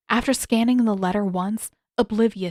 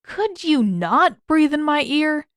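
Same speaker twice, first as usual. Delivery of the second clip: annoyed complaint; 7 semitones higher, 5 dB louder, slower than usual. A narrator's reading. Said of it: "Sokka bumped Aang with his shoulder".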